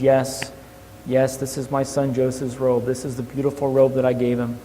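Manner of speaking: soft, broken-hearted and hushed; grieving father. A narrator's reading. Jacob grieving over his son's robe, soft and broken. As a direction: let the grief pull the voice down low and soft. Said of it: "he begins weeping and crying".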